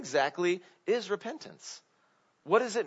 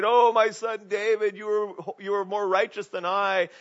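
tearful; 4 semitones higher, 5 dB louder, slower than usual. Retold or imitated. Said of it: "Saul would cry and say".

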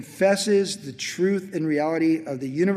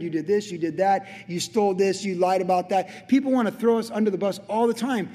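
higher, driving sermon delivery; full-force preaching; 4 semitones higher, 3 dB louder than usual. Acted. Preacher decrying those who write people off, forceful preaching. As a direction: press the accusations home, pitch climbing. Preaches against condemning others.

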